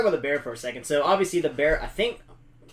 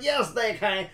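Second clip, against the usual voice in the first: higher, light Southern drawl on "Yes, they can"; a touch of a hammed-up Southern drawl; mock Southern accent; 7 semitones higher, slower than usual. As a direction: imitate a movie's overdone Southern accent, lightly. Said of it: "thickest Southern accent".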